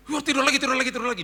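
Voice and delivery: loud, high flustered repetition. Retold or imitated. Housekeeper sending woken employer back to bed, flustered. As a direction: imitate a housekeeper hastily backing off.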